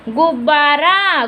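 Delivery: "गुब्बारा" slowly split after the first ब; slow, measured "गुब्-बा-रा"; sounding out. Shown as a demonstration. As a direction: dictate "गुब्बारा" syllable by syllable, vowels stretched. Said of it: "गु, फिर उसके बाद आधा ब".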